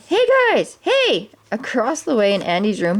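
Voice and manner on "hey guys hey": calling out. Acted. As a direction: call across the gap to friends. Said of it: "Woody called out".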